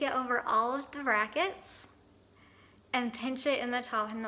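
lips held apart by fingers; speaking while pressing teeth; talking with fingers in mouth. Real, not acted. Seen 2: Both hands pull lips back, pressing wax onto teeth while talking.

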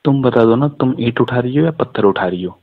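ordinary delivery

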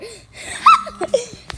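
loud burst of laughter; laughing. Pretend.

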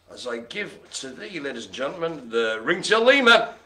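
ordinary talk, nothing marked

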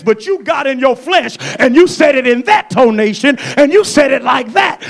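preacher shouting about speaking in the flesh; preaching shout. Acted. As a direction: thunder it out at the congregation. Preacher acts fleshly angry speech.

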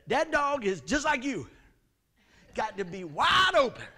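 loud, slow, laughing mock wife; hammed-up teasing from the wife; wife teasing him that the dog is just like him, loud and laughing; imitating wife. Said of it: "Tanya said".

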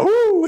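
high, loud mock "woo"; startled whoop. Preacher imitating Johnny caught at the bank, startled whoop. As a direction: whoop as if jolted.

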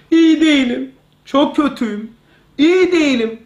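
mock self-discouragement in short, separate phrases; olumsuz telkin. Shown as a demonstration. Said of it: "Tersini söyle". (olumsuz telkin örneği, hafif yükselen sesle)

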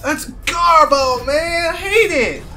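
high, loud outburst; whiny, heated gamer complaint, pitched high; exasperated rant. Real, not acted. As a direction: yell your disgust at the game. Ranting after game setback.

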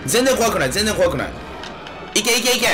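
tipsy, high and excited. Real, not acted. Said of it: "酔っ払ってるから全然怖くない". (酔っ払った声)

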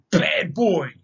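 loud, high angry yell; miner's yell. A narrator's reading. Startled miner yelling at the dog, loud and high. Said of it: "The miner yelled".

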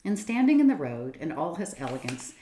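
plain reading voice

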